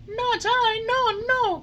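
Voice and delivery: denying it in bitter tears; sobbing Pinocchio. A narrator's reading. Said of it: "cried Pinocchio, sobbing bitterly".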